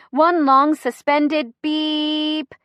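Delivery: high, slow, stretched last word; drawn out. A narrator's reading. Driver blasts horn at SUV, BEEP drawn out. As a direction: hold BEEP long like a horn. Narrator draws out horn sound.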